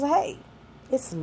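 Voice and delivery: usual speaking voice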